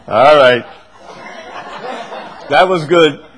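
laughing. Real, not acted. Laughing after a joke.

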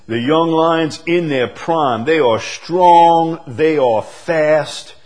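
high, loud, slow preaching; preaching at full force. Real, not acted. Preacher exhorting the congregation about young lions, loud and slow. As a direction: lift the hall with it, slowly.

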